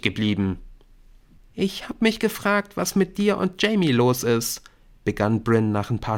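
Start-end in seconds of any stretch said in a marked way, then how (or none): 1.5-4.6 put-on high girl's voice, probing; pry in a high, girlish voice; prying schoolgirl. A narrator's reading. Brynn asks Nick about him and Jamie, high and prying.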